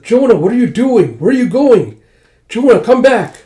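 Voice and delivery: high, loud shouted questions; urgent calling out. Acted. Pernille shouts after Jonah heading outside, high and urgent. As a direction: holler after someone walking away.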